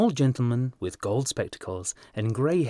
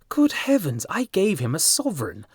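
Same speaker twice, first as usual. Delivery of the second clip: much higher, louder cry of dismay; 8 semitones higher, 3 dB louder than usual. A narrator's reading. Hughie horrified at having tipped a millionaire, crying out. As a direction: cry out in horror. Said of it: "cried Hughie".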